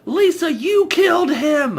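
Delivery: Denny accusing Lisa in a shrill cry; shrill mock shout. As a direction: yell it in a squeaky hammed-up voice. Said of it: "It says".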